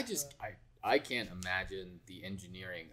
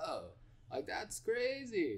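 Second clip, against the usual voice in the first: amazed; 12 semitones higher, 5 dB quieter, slower than usual. Retold or imitated. Recalls amazed reaction to episode.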